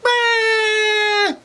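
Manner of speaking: very high, loud mock shriek; long shrill scream back at her; answering scream. Bears screaming back at the girl, startled shriek. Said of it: "And they're like".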